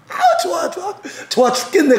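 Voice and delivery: a playful devil who can't stop laughing for joy; gleeful laughing devil. Acted. Devil delighting in story.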